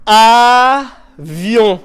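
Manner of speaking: "Avion" loud, split into clapped beats a-vion; syllable clapping. Shown as a demonstration. Teacher clapping out "Avion" with the class. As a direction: clap each beat of "Avion". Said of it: "on frappe dans les mains".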